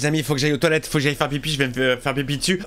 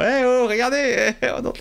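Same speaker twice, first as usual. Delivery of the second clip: Freddy voice; 9 semitones higher than usual. Retold or imitated. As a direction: tease the audience like a horror villain. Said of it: "il nous parle à nous".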